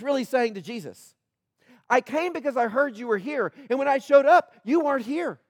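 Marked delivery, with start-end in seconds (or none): 1.9-5.4 higher, pressing complaint; father's urgent plea. Retold or imitated. Desperate father telling Jesus how the disciples failed him, urgent. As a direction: pour out the frustration, talking fast.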